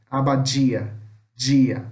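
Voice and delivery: "Abadia, dia" with a "dji" sound; teacher showing the hushing D of "Abadia, dia"; hissed Portuguese D. Shown as a demonstration. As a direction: make the D in "dia" hiss like "jee". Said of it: "esse som meio chiado".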